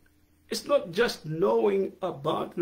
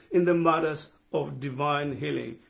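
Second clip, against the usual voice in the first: ordinary delivery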